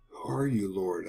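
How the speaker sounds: quietly acted, awed question; hushed question. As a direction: ask it under your breath. Paul answers the heavenly voice.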